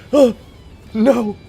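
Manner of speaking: loud, high dismayed recoil; cringing reaction. Acted. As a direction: recoil in exaggerated horror at something cringey. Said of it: "I'm immediately just like".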